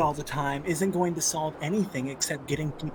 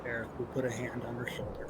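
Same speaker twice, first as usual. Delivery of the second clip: whispered narration; whispered table narration; 3 semitones lower, 7 dB quieter than usual. Real, not acted. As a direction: breathe it out under your voice. Quiet narration of tender gesture.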